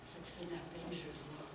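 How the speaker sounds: quiet confession. Acted. Quiet confession to her child.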